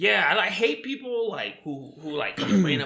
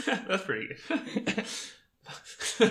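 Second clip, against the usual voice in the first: laughing; 4 semitones higher, 7 dB quieter than usual. Real, not acted. Laughing at friend's joke.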